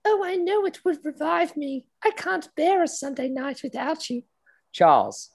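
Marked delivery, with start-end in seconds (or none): 0.0-4.2 high, airy put-on posh lady voice; breathless high society lover; Camilla voice. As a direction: sigh it out like a swooning aristocrat.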